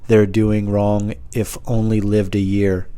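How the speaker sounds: slow, word-by-word recital; drawn-out, portentous reading; deliberate note reading. Pretend. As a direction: read the nonsense out slowly and carefully. Reading nonsense note aloud.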